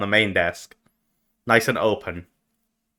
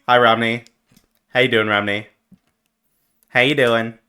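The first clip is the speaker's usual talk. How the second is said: usual speaking voice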